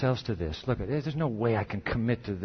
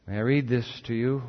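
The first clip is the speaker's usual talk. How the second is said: ordinary talk, nothing marked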